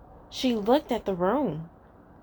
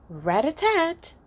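higher, rapped-out knock; knock sound; 7 semitones higher than usual. Acted. Narrator sounds the knock at the door, high rapped-out knock. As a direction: tap it out like knuckles on a door.

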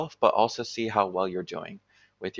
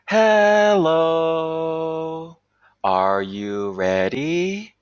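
much higher and louder, then stretched very slowly; exaggerated voice dragging; 7 semitones higher, 6 dB louder, much slower than usual. Shown as a demonstration. Teacher demonstrating bad voice dragging to trainee teachers, slow stretched swoops. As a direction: swoop up on hello, then drag out every vowel. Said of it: "You see how I'm dragging that out?".

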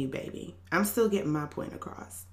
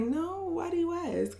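coy reply; 12 semitones higher than usual. Retold or imitated. Retold reply to flirting stranger.

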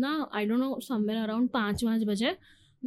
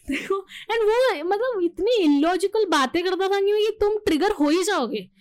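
high, loud, laughing as she remembers it; 7 semitones higher, 3 dB louder than usual. Real, not acted. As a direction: laugh it out, higher and louder. Laughs recalling the fight.

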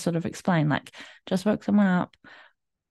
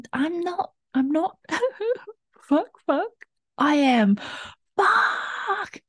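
high, breaking voice with a sob; swearing through tears, pitch much higher; reenacted crying; 11 semitones higher, slower than usual. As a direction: relive the moment you broke down in tears. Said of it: "starting to cry".

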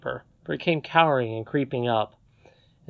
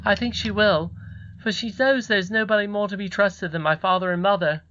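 put-on proud boy in a higher voice; 7 semitones higher than usual. A narrator's reading. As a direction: say it with a son's pride. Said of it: "said Curdie with pride".

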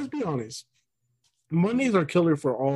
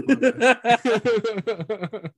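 laughing; 8 semitones higher than usual. Real, not acted. Laughing after joke slip.

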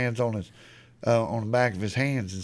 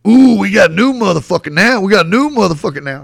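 loud, raised sing-song jeer; mock taunt; 5 semitones higher, 10 dB louder than usual. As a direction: imitate a cocky kid heckling a newcomer. Retold kid taunting the new player.

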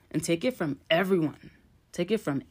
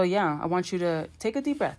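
normal talking voice

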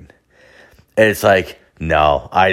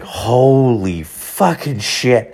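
slow, drawn-out awe, pitched up; stunned amazement; 5 semitones higher, much slower than usual. Retold or imitated. Tripping user after the hallucinations stop, stunned amazement. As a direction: stretch each word out in acted disbelief.

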